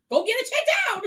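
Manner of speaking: mock-yell it at a complaining friend; exasperated order. Retelling exasperated advice to friend.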